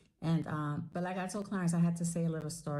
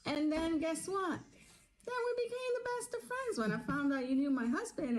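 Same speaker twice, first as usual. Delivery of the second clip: excited reveal; 11 semitones higher than usual. Real, not acted. Story punchline, lively retelling.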